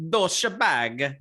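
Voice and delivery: put-on Italian accent; mock Italian. Host mimics the app's Italian-sounding insult, mock Italian accent. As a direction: say it like a caricature Italian. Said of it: "sounded very Italian".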